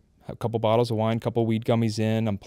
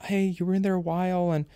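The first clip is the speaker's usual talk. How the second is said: higher-pitched mock wife voice, mildly complaining; imitating wife; 8 semitones higher, faster than usual. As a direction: lightly voice the wife's nagging about the spaghetti. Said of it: "she's going".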